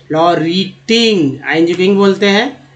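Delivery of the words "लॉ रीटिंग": लॉ रीटिंग split into long slow parts; careful teacher's reading of लॉ रीटिंग; slow word demo. Shown as a demonstration. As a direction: stretch each part of लॉ रीटिंग. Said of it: "इस तरह से आप पढ़ना सीख".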